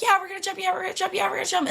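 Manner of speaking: high, rapid excited chant; hyped-up group chatter. Retold or imitated. Coworkers all agreeing to jump, mock-eager. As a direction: imitate an eager crowd, pitched well up.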